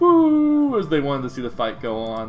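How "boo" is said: high, loud, long boo; crowd booing. Acted. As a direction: boo like a crowd wanting the fight. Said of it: "like, a hush, kinda like".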